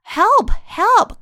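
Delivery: high, loud acted plea; page crying out. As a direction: cry for help as the storybook page. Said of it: "cried the page".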